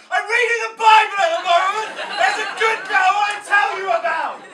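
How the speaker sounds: shouted, high and loud, laughing through it; mock street preacher shout. Comic mocking a Bible street preacher, loud laughing shout. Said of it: "marching up and down saying".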